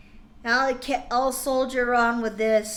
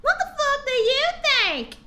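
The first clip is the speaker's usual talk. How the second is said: high hammed-up angry retort; mock angry snap; 13 semitones higher, 3 dB louder than usual. [name] snapping back at Bloody Mary, mock angry. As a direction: fire it back in mock outrage. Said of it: "I asked angrily".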